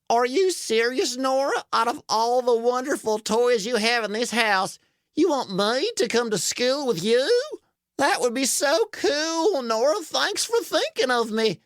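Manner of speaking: high, amazed and grateful truck; thrilled little toy truck, pitched high; Dumpy. Acted. Voices Dumpy, thrilled at invitation.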